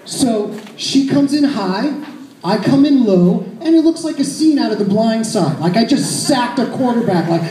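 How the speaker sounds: usual speaking voice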